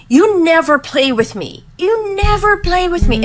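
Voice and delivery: high whining daughter, rising as she repeats it; imitating whiny child. Mother retelling her daughter's complaint in a whiny child voice. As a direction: pitch up into a pleading little girl. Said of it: "And then she started bawling".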